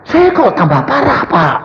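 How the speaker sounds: high-pitched plea to the pastor; person complaining to pastor. Acted. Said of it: "kalau ada orang cerita".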